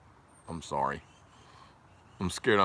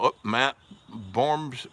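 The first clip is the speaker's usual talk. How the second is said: ordinary talk, nothing marked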